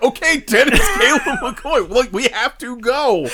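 laughing through put-on impatience; mock annoyed. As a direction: imitate someone fed up and trying to leave. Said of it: "they're getting annoyed".

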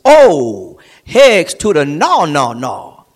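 emphatic no, loud start, dropping low; even stronger refusal. Acted. Preacher showing how strong Paul is, hammered emphatic refusal. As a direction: hit "Oh" hard, then drive each "no" down. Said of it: "even stronger and more emphatic than that".

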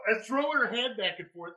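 normal talking voice

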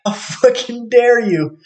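high, slow outraged jeer; mock outraged. Imagined reply to someone he's angry at, high and slow mocking outrage. Repeated imagined spiteful outburst.

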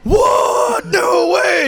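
very high, loud disbelieving shout; shocked shout. Retold or imitated. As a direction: yell it like you've just met the brewer. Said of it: "And just, like, freaked".

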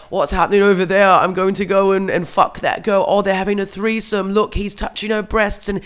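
loud, high, hammed-up Northern English accent; bad Northern English accent. Retelling the rough hostel guy across the room, in a bad Northern English accent. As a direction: belt out a badly faked Northern English accent. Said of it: "he's, like, very Northern English".

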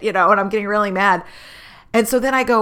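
usual speaking voice

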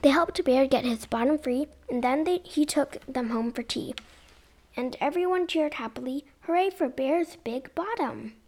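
lively sing-song read-aloud; storybook reading. Acted. Mum reading a picture book to kids, lively. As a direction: read it like bedtime story time.

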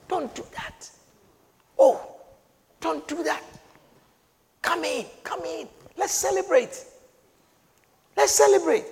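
soft, high pleading father; a tender father begging his son to join. Acted. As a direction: coax him gently to come in. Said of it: "Pleading with him".

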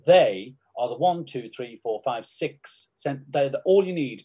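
ordinary delivery

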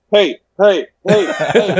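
put-on drunken calling out. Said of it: "I'm drunk with a bottle".

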